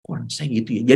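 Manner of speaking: faint dazed voice. Retold or imitated. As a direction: barely get the words out, as if drifting. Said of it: "udah kayak halusinasi".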